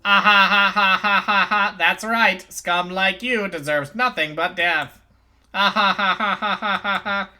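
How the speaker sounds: loud mocking cackle; gleeful cruel laughing voice; villain laughter. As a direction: cackle over the condemned girl. Villain mocks doomed girl.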